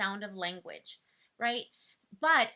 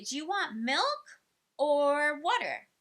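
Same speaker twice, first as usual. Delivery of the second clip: put-on higher, slow, drawn-out question to a child; offering a choice; 5 semitones higher, 4 dB louder, slower than usual. Shown as a demonstration. Parent giving a thirsty kid a choice, slow and clear. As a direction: lay out the two choices slowly. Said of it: "And you say".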